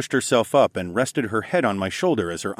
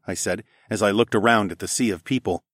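ordinary delivery, nothing marked

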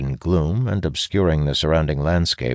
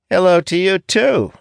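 very high, loud mock greeting; sarcastic; 6 dB louder than usual. A narrator's reading. Young apprentice answering a curt greeting, sarcastically. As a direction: throw the greeting back with exaggerated pitch. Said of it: "he replies sarcastically".

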